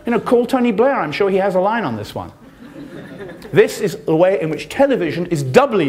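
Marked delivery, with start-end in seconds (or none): 0.0-1.0 high, slow mocking sarcasm; mocking the hypocrisy, pitched way up; bitter sarcasm. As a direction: drag it out scornfully. Bitter sarcasm about respect.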